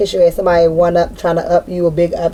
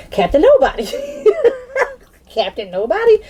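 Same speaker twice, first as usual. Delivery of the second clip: laughing aloud. Real, not acted. Laughing at the joke.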